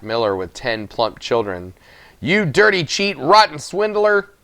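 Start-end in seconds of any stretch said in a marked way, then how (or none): 2.2-4.3 put-on angry old man. Rupert insulting the miller.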